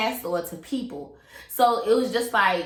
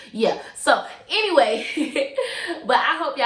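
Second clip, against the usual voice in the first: laughing it off; 6 semitones higher, 5 dB louder than usual. Real, not acted. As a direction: laugh through the words. Laughs at own joke.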